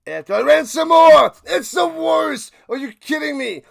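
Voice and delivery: real shouting outburst at the refs; rant shout. Real, not acted. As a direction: explode back into the rant. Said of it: "I'm done ranting".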